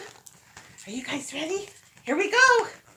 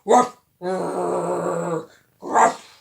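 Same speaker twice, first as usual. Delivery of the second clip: guard dog bark; 8 semitones lower, 3 dB louder than usual. Shown as a demonstration. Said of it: "Careful of the guard dog".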